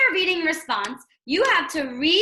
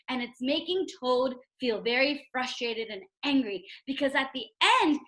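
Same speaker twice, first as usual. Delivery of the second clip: ordinary delivery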